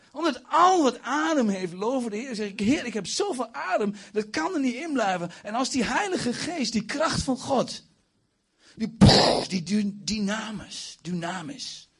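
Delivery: high-pitched fervent outpouring; fervent preaching. Real, not acted. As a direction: preach it high, bursting with zeal. Preacher demonstrates ecstatic seeking. (vurig, hoog en opgewonden)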